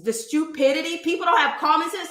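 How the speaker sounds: ordinary talk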